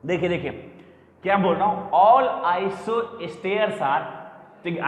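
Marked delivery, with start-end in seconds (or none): 2.0-4.0 very slow, word-by-word teaching; deliberate, spaced-out delivery for students; slow restatement. Real, not acted. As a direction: draw out each word of the rule. Teacher restates the rule slowly.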